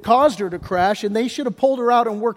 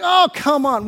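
mock annoyed; 6 semitones higher, 4 dB louder than usual. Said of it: "copping an attitude with me".

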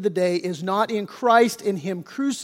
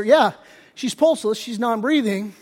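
usual speaking voice

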